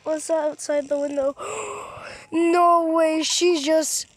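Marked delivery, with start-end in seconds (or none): 1.4-2.3 quick startled intake of breath; sudden fearful gasp; sharp gasp. Real, not acted. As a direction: gasp in shock. Startled by thing outside.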